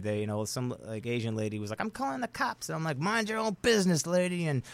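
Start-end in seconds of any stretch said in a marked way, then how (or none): 1.8-2.7 high, soft imitation of an alarmed lady; mock scared bystander. Scared lady threatening to call police, mock-alarmed high voice. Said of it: "everyone that was in the parking lot was scared". 3.0-4.5 high-pitched, dismissive comeback; retold irritated retort. Telling off a lady in the parking lot, irritated retort. Snapping back at a bystander.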